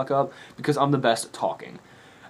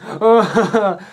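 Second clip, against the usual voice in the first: high, louder laughing gasp; 9 semitones higher, 8 dB louder than usual. Retold or imitated. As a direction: let the oh break into a laugh. Jaden reacting, laughing.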